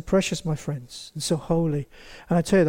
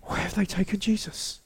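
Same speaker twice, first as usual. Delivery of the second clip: hushed panic; 8 dB quieter than usual. A narrator's reading. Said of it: "a blind panic".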